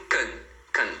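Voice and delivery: can with a weak, barely heard vowel; reduced can. Shown as a demonstration. Teacher shows the affirmative, can barely pronounced. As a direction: swallow the vowel in can to a short kn. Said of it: "você quase não ouve".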